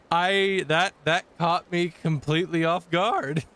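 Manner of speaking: genuinely thrown off guard; startled disbelief; surprised. Real, not acted. Caught off guard by game.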